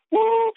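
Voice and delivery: very high excited woo; hype-man whoop; cheer. Real, not acted. Cheering after quote.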